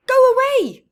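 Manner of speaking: high, loud last go away; final shout. Acted. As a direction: shriek the end.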